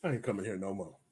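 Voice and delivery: low, very soft retold aside; hushed aside. Diner's reaction to the owner, hushed low aside. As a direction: drop low and quiet, as if under the breath.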